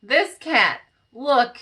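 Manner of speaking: normal talking voice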